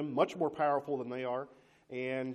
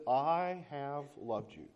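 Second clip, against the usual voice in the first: 1 tender divine declaration; much slower than usual. Acted. God's words in sermon.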